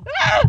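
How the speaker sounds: short, loud, high scream; real startled shriek; frightened scream. Real, not acted. Player scared by her phone, sudden scream. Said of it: "My phone scared the shit out of me".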